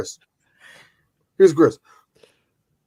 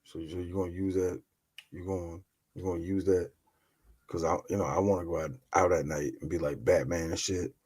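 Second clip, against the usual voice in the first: low hushed voice, much softer; sheepish low-voiced request; hushed ask; 11 semitones lower, 15 dB quieter than usual. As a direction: lean in and ask quietly. Asking Celia in low voice.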